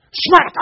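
shouted smack noise; loud mock slap sound; smack sound effect. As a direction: yell the smack like a cartoon hit. Son hits himself with jump rope.

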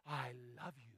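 low, soft doting dog voice; adoring dog. Acted. Dog adoring its owner, low and soft. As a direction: murmur it low like a lovestruck dog. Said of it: "And it's like".

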